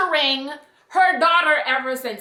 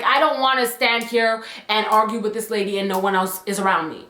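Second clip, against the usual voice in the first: usual speaking voice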